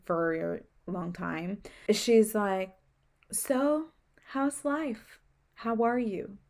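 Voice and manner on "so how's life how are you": gently imitating a soft-spoken doctor, pitched higher; a light take on the nurturing doctor. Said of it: "soft spoken voice".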